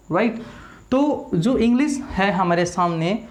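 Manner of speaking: ordinary talk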